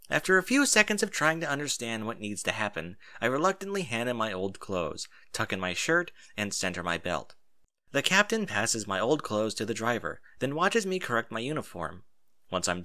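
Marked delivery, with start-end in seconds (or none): none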